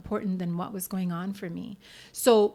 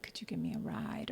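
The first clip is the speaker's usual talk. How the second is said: whispered, lower, asking a favour; whispered request; 12 dB quieter than usual. Retold or imitated. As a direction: ask for a ride in a sheepish whisper. Begging a friend for a ride.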